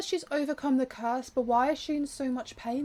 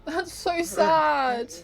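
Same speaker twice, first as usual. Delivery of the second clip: genuinely saddened; 4 semitones higher, 4 dB louder, much slower than usual. Real, not acted. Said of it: "this is actually making me emotional".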